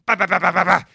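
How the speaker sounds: rapid-fire "bah" bursts; imitated gunfire. Hunter fires at the bears, imitated gunfire. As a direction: spit out a machine-gun volley by mouth.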